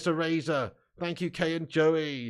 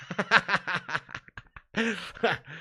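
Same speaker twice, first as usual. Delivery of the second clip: long run of laughter; real laugh; 4 dB quieter than usual. Real, not acted. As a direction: crack up at the teasing. Laughs at being shamed thin.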